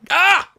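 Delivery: sharp loud "ah" of annoyance; frustrated high yelp. Real, not acted. Host catches his own slip, frustrated yelp.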